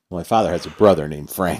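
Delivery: ordinary delivery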